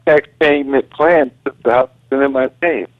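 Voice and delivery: ordinary talk, nothing marked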